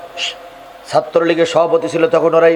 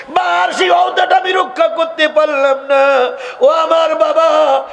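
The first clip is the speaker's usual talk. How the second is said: high, slow chanted wail; acted lament; 10 semitones higher, slower than usual. Son regretting his broken promise to mother, high wailing lament. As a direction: cry out to father in a stretched, high chant.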